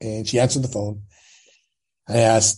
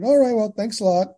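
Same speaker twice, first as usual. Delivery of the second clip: high, sing-out thanks; mock-casual high farewell; acted polite sign-off; 10 semitones higher than usual. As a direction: re-enact a breezy goodbye, pitched up. His acted parting at the door.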